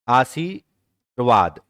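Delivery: आशीर्वाद slowly, each syllable clear; slow classroom reading of आशीर्वाद; slow correct reading. Shown as a demonstration. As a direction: draw out आशीर्वाद for students. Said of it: "ठीक है, उसके बाद".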